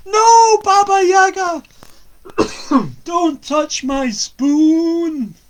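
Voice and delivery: very loud, high, slow cry; shout. Acted. Young lad shouting at Baba Yaga from the cupboard. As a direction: yell out in desperate protest. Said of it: "the young lad shouted out".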